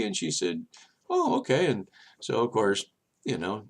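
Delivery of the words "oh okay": imitated pleased agreement. Woman accepting the therapy offer.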